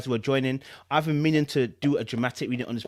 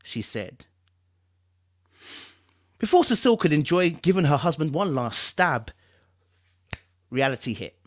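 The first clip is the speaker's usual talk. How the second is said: measured storytelling voice, lightly dramatic; dramatic story narration; 3 semitones higher than usual. Acted. Reading a novel's prologue to viewers, dramatic narration. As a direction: draw the listener into a dark story.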